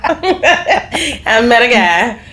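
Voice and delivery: giggling as she says it again; laughing. Real, not acted. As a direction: stretch it out through a laugh. Repeating it for laughs.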